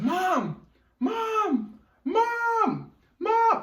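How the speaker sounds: high yelled calls down the stairs; calling out. Retold or imitated. Kid calling mom from upstairs.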